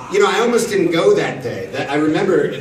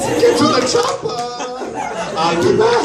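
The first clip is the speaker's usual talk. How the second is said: Arnold Schwarzenegger impression. He shows his Arnold impression with famous shouted lines. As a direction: bellow the lines in a cartoon-high Arnold voice. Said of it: "Austria doing Arnold Schwarzenegger lines".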